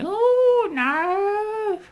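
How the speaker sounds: high, loud shrill-free mock of prim old biddies; mocking prudish old ladies. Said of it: "their blue rinse knitting set ... going".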